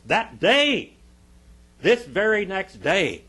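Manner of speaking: loud, high pulpit emphasis; forceful preaching. Acted. Preacher stressing what priests would do next day, forceful preaching. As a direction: hammer each phrase at the congregation.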